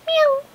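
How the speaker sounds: high mewing cat sound; high cat meow. A narrator's reading. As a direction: mew like a sleepy cat. Cat answers in story.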